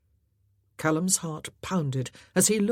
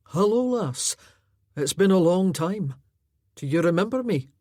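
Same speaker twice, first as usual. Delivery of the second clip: gentle foster brother; 5 dB louder than usual. Acted. Foster brother greeting the lass gently, friendly. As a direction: greet her kindly.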